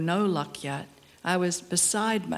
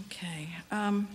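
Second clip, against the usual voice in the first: whisper; 7 dB quieter than usual. Real, not acted. Aside between poems.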